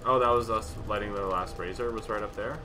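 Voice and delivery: usual speaking voice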